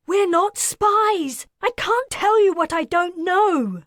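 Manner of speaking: high, loud, pleading protest; desperate female voice. Acted. Wilder pleading with the leader, desperate and high. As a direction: make them believe you, desperately.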